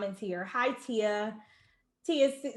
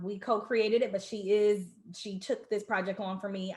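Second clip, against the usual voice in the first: ordinary delivery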